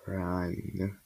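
soft low one-word warning; hushed warning. A narrator's reading. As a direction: breathe it out as a dread warning. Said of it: "she said".

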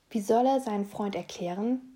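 ordinary delivery

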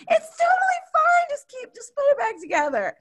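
very high, squeaky laugh-cry plea. Retold or imitated. To husband as the house collapses, laughing high squeal. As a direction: squeal it through helpless laughter.

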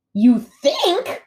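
high, loud squawk of disbelief; squawking gosling. A narrator's reading. Gosling questioning his robot mother, squawking. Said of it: "squawked Bright Bill".

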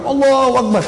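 high-pitched, ringing communal cry; loud takbir. Retold or imitated. Everyone at Darul Arkam cries takbir, proclaimed high. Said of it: "Saking kerasnya takbirnya".

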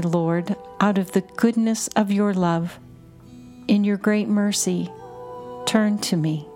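usual speaking voice

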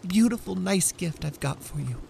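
put-on high, eager young wife; bubbling over with delight; Della, excited. Wife offering her husband a gift, eager and high.

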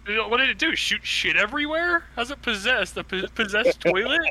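rising, incredulous mock questions. Real, not acted. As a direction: pitch climbing high in disbelief. Mocking the possessed potty story.

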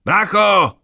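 full-throated high yell; desperate shout into the echo; shouting with all his might. A narrator's reading. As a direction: scream for your brother as loud as you can. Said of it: "Znovu zakřičel, jak to jen šlo".

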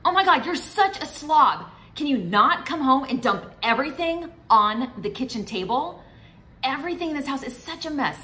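high, loud exasperated scolding; accusing wife. Retold or imitated. Wife berating husband for dumping things, high loud accusing yell. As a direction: hurl the insult at a messy husband. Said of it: "stay away from all global labels".

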